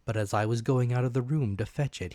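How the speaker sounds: ordinary delivery, nothing marked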